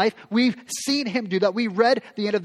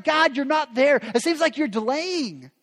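frustrated lament; 7 semitones higher than usual. Retold or imitated. Voicing frustrated prayer.

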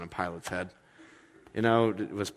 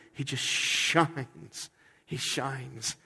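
awed; 3 semitones higher, 4 dB quieter, much slower than usual. Real, not acted. Preacher's awed climax.

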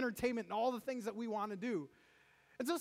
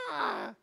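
high-pitched mock yell; drawn-out "aah" of pressure; mock inner scream; 5 semitones higher, 4 dB louder than usual. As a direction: let out a pent-up scream, held back a little. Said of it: "we're feeling that internally".

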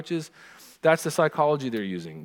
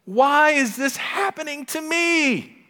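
put-on high, slow, drawn-out whine of a sick kid; whiny sick child; 6 dB louder, slower than usual. Parent mimicking sick child's complaint, high whining wail. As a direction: wail it like a miserable feverish child. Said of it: "words that came out of this child's mouth".

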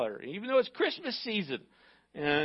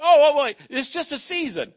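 high, much louder "Oh, oh, wait" of someone dodging; mock excuse; 6 semitones higher, 10 dB louder than usual. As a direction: imitate the believer making excuses, higher. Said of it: "We're like".

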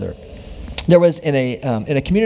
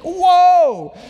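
surprised protest; 9 semitones higher, 3 dB louder, much slower than usual. Retold or imitated. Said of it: "And she was like".

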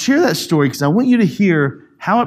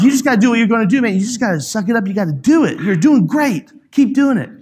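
worked-up pep talk, higher and pushing; raised voice; 8 semitones higher, 3 dB louder, faster than usual. Retold or imitated. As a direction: retell a dad getting heated as he urges his son on. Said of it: "Because I raised my voice".

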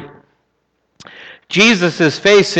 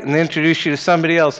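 usual speaking voice

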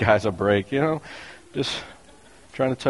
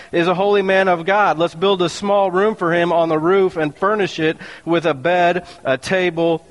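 higher, lifted reading voice; scripture reading; 6 semitones higher than usual. Acted. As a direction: read the Bible passage to the church. Reads scripture aloud.

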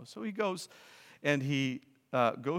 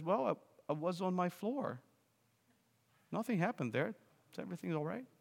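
calm reply; 6 semitones higher, 4 dB quieter than usual. Acted. Jesus calmly answers angry man.

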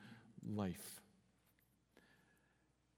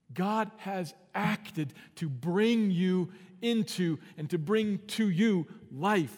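loud, high, slowed preaching; full-force preaching; 7 semitones higher, 8 dB louder, slower than usual. Acted. Preacher proclaiming new life to the congregation, loud and slow. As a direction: drive it home from the pulpit.

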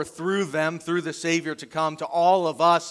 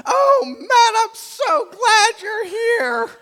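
high, slow, near-tearful welcome; gushing overjoyed wife; 8 dB louder, much slower than usual. Retold or imitated. Wife greeting her husband home, mock tearful and high. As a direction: ham up the teary reunion.

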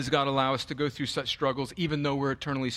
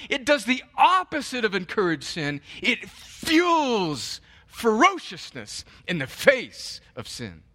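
louder, higher, driving sermon voice; passionate preaching; 8 semitones higher, 4 dB louder, slower than usual. Acted. Pastor driving the point home to his flock, fervent preaching. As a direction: preach it hard to the congregation.

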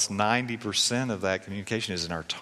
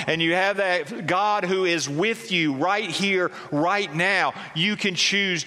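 higher, insistent, with "God is near" and "God is there" drawn out slowly; fervent preaching; 8 semitones higher than usual. Acted. Pastor assuring listeners God is near, fervent preaching. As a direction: drive home that God is here now.

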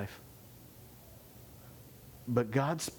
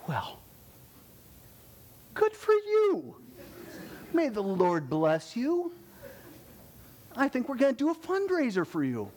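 sarcastic parent; 10 semitones higher than usual. Pretend. Parent mock-blessing a child who wants to go to cannibals, high and sarcastic. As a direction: coo it like a parent pretending to be proud. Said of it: "How many parents would say".

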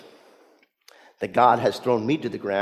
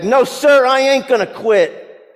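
higher, louder defiant yell back at the sergeant; defiant shout; 13 semitones higher, 10 dB louder than usual. Retold or imitated. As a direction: shout it through gritted teeth, refusing to quit. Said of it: "Mayo shouts back".

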